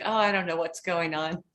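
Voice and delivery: usual speaking voice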